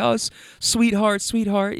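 a rider's wife fondly calling the driver sweetheart, mildly imitated; imitating the lady. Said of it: "She kept calling me sweetheart".

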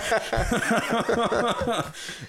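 long high laughter; real laugh. Real, not acted. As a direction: give in to laughing at your own imitation. Laughing at his own impression.

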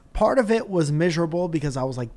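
normal talking voice

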